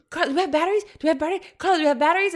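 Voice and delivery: imitating husband hollering from another room; calling for batteries. Husband yelling to wife from the other room, high calls.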